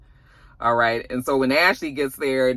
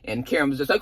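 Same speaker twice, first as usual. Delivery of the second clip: usual speaking voice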